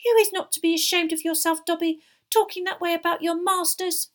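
high, loud, scolding elf; shrill angry house-elf; furious squeal. A narrator's reading. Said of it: "squealed Winky furiously".

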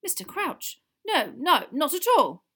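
high, loud hurried denial; horrified backtracking. A narrator's reading. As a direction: protest anxiously to a superior.

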